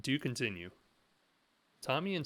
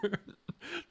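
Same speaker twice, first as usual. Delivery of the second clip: a sudden high giggle; amused high-pitched laugh. Real, not acted. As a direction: burst into a squeaky giggle. Laugh after sarcasm.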